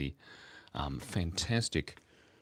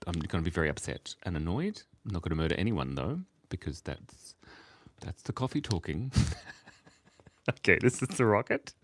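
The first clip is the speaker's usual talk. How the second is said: ordinary delivery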